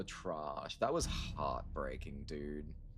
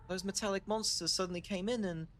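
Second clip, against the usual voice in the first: much higher put-on girl's voice; high girlish voice; 13 semitones higher than usual. Shun Shun relieved Yi is awake, high girlish voice. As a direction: lift way up into a young girl's voice.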